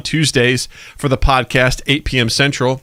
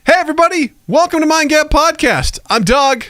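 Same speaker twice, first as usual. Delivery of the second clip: high energetic show welcome; podcast host intro; 3 dB louder than usual. Acted. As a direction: open the show with big energy.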